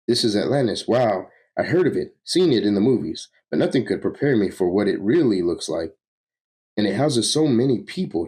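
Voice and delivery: lively, lilting voice of wonder; awed enthusiast. Acted. Newcomer marvels at Atlantis to his friends, awed. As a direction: marvel aloud with swooping pitch. Plays awed character in story.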